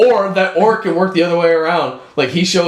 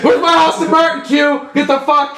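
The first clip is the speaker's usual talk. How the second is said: hammed-up bossy agent; mock demanding shout; 9 semitones higher than usual. Bond barking at Q.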